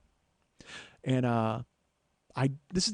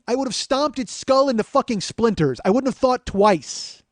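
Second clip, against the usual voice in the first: rising anger; 8 semitones higher, 7 dB louder than usual. Real, not acted. Rage at the bro.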